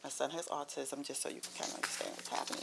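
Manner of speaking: hushed retold whisper, barely voiced. Mother tells Robert about her son in a hushed whisper. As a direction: lean in and whisper it privately. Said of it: "I whispered".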